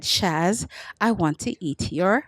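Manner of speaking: put-on low, slow, lightly French-accented seducer; French lover. Comic voicing the French match's text, low light French accent. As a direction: purr it like a smooth Frenchman, lightly. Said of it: "He basically said".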